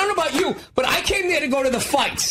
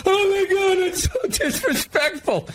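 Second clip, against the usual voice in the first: mock offended; 8 semitones higher than usual. Trump fans upset at mockery, shrill mock outrage. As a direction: whine like a scandalized fan. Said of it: "They're like".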